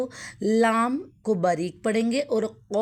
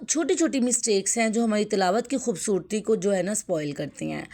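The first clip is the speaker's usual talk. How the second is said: ordinary talk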